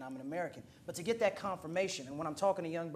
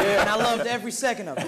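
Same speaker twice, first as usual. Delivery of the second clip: words spilling through a loud laugh; 5 semitones higher, 12 dB louder than usual. Real, not acted. Young man to the circle, loudly laughing with joy. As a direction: laugh it out, delighted.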